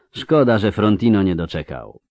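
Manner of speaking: usual speaking voice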